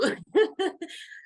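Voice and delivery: high bright laugh through tears; relieved giggle; tearful laugh. Real, not acted. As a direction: laugh it off after crying. Happy laugh after crying.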